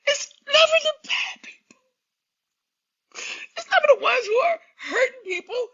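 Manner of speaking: high, loud, sobbing cries; pleading through real tears; real crying. Real, not acted. Grieving streamer crying out at God's unfairness, sobbing. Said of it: "Now I'm all messy".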